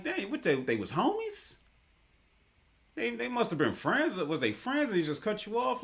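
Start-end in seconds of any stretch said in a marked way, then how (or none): 0.0-1.6 high, surprised disbelief; a confused double take, much higher. Retold or imitated. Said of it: "And I'm sitting there like".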